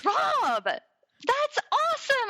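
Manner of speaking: high, drawn-out retold cheer; delighted husband. Husband hears the pregnancy news, imitated delight. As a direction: imitate an overjoyed reaction, way up high.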